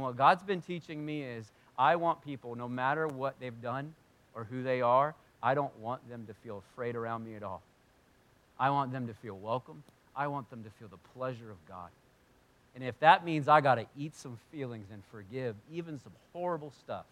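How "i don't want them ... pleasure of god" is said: usual speaking voice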